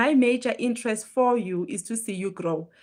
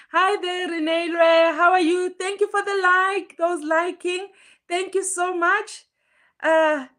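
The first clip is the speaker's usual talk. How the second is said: higher, louder, eager welcome to each viewer; excited greeting; 7 semitones higher, 4 dB louder than usual. Real, not acted. Livestream host welcoming Renee Lwe and viewers, excited and higher. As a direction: greet everyone who joins with lifted energy.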